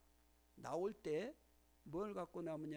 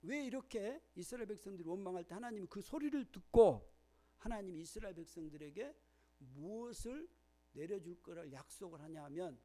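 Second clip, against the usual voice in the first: ordinary talk, nothing marked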